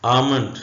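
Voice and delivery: आमंड said firmly as aa-mand, no l sound; silent l. Shown as a demonstration. Teacher to students, firm आमंड with silent l. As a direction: say आमंड clearly, leaving out the l. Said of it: "silent letter एल".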